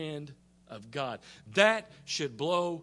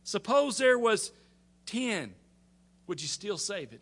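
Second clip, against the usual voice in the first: high, halting bargain, easing down at the end; Abraham's last plea; 6 semitones higher, 5 dB louder than usual. Retold or imitated. Abraham making his final offer to the Lord, high pleading. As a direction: pause before the number, then ask gently. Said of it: "irritating little friends".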